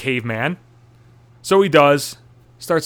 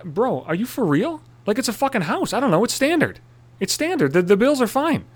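exasperated rant; 8 semitones higher than usual. Retold or imitated. Exasperated rant at house viewer.

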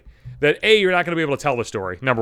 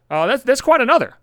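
ordinary talk, nothing marked

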